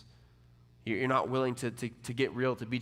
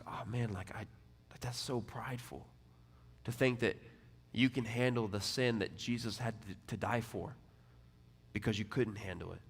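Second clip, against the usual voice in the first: gentle rebuke; 2 semitones lower, 9 dB quieter than usual. Retold or imitated. Pastor softly rebuking a struggling young man, tender. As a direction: quietly press the point with care.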